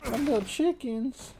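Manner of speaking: high squeaky pitying whine; mock pity voice. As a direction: whine for the poor chickens. Pitying dying chickens.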